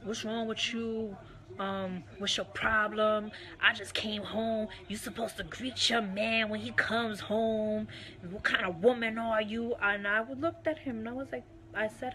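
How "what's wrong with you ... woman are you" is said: slow, pressing, accusing mock rant; retelling her husband demanding a greeting, in a mildly angry put-on voice; imitating confrontational husband. Husband scolding wife, slowed, indignant imitation. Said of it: "he was very confrontational".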